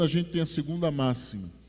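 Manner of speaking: ordinary delivery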